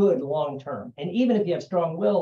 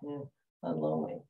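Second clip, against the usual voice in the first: mopey lonely sigh; 12 semitones lower, 9 dB quieter than usual. Retold or imitated. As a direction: drop way down low and soft, sighing. Mock lonely friend on weekend.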